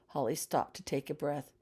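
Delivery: plain reading voice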